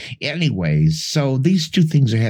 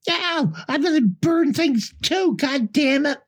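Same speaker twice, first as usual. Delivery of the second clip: much higher childish voice, hammed-up tantrum; mock little girl. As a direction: squeak it out like a bratty kid. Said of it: "She's like".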